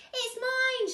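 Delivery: high, loud claim; shout. A narrator's reading. Cyril shouts at Bruce over the cone, high and loud. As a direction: yell it's yours alone. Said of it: "shouted Cyril".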